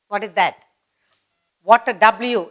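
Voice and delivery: ordinary delivery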